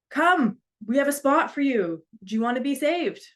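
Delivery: called out high and loud over the water; rescuers yelling. Retold or imitated. Firefighters on a boat yelling up to a man on his roof. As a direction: yell the offer up to the roof. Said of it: "firefighters yell out to him".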